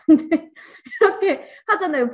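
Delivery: loud, high laugh running into speech. Real, not acted. Teacher admitting what we usually do, laughing. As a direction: keep talking through real laughter. Laughs after teasing the audience.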